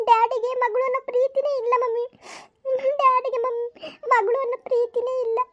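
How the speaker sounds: put-on a hurt child sobbing out her complaint to mom; tearful pleading daughter. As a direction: daughter pleading with mother through tears. Daughter begs mother, crying.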